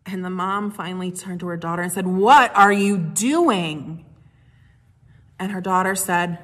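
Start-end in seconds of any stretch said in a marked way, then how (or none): 2.1-4.0 loud, slow, drawn-out exclaim; a mother calling her child to stop; startled mom. Retold or imitated. Mother turns to her clapping daughter, loud slow startled question.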